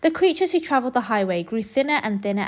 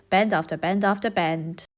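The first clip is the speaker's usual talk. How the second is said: usual speaking voice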